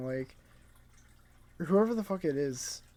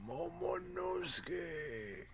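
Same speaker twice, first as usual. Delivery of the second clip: very soft, long drawn-out calling voice; hushed, slow creature impression; Zunisha; 14 dB quieter, much slower than usual. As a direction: stretch the name out slowly and quietly, in character. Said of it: "or however he sounds".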